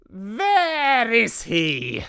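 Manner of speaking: high, loud, slow witch voice; a witch hunting for the mouse; witch's snap. A narrator's reading. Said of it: "snapped the Grand High Witch".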